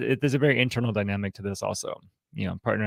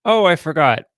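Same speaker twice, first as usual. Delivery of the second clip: airy, higher "Oh, I forgot" brushing it off; breezy excuse; 7 semitones higher, 9 dB louder than usual. Acted. The liar answering the partner, brushing it off lightly. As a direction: toss off a lighter, louder excuse.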